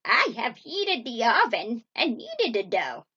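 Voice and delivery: loud, swooping witch voice; scheming witch. A narrator's reading. Witch readies the oven for Gretel, loud sly voice. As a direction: announce it with wicked relish. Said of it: "said the witch".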